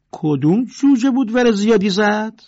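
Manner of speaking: loud, high taunting demand; who dared speak up; angry bully's shout; menacing challenge. Acted.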